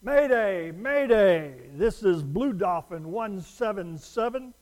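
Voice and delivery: frantic radio operator calling mayday. Acted. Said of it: "you hear something like this".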